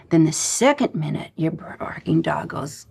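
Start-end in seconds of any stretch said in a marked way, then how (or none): none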